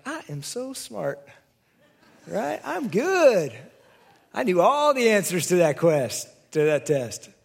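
mock student bragging after passing a test; smug bragging. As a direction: puff yourself up in mock pride. Mocking our boastful selves.

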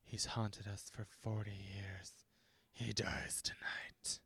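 slow, low, hushed solemnity; mock dramatic whisper. Mocking characters' dramatic stares, slow whisper. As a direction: whisper it with overdone gravity. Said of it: "they give dramatic stares".

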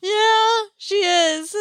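high, put-on agreement; drawn-out high mimicking voice; mimicking reluctant voice. Joins in the Rudy imitation.

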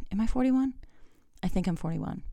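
half to herself, doubting her age; unsure aside. Real, not acted. Podcaster doubting her own age, soft muttered aside. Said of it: "that also happens when you get older".

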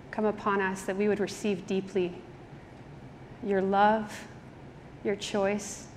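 soft, hushed prayer voice; quiet prayer. Acted.

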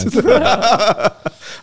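high self-conscious laugh; embarrassed laugh. Real, not acted. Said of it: "I think I annoyed myself doing that".

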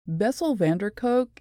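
ordinary talk, nothing marked

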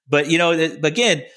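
ordinary talk

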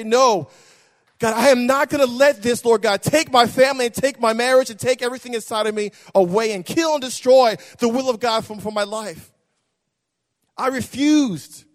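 loud, high preacher's cry; sung-out sermon cry; preaching at full force. Acted.

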